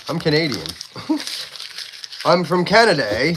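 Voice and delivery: high, loud mock Canadian proclamation; hammed-up Canadian. Streamer answers a viewer who heard American, hammed-up Canadian. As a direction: play up a cheery Canadian accent.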